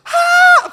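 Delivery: loud, high freak-out scream; panicked yelp. Retold or imitated. Storyteller acting out getting the Facebook threat, panicked yelp. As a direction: let out a sharp, high yell of panic.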